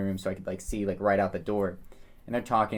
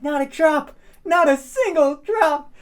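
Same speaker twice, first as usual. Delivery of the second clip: much higher and louder, whooping; triumphant shout; 6 dB louder than usual. Retold or imitated. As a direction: crow it like the drunk buddy cheering a miracle. Buddy cheering again.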